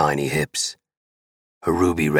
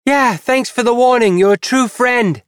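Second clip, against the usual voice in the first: very high, loud sarcastic yelling; 7 dB louder than usual. Acted. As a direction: yell it sarcastically from another room. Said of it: "pissed off voice ... when she yells".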